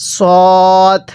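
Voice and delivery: সদ with heavy s and long rounded o; slow, held model সদ; demonstrated SAAD sound. Shown as a demonstration. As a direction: stretch সদ as "sooo-d", level. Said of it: "জিহ্বার আগা সামনের নিচে".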